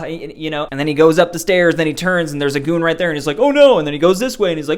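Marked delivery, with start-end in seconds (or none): 3.4-3.8 high, loud hammed-up alarm; mock alarmed oh no. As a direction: play Bond's mock panic at the goon. Acting out Bond meeting goons.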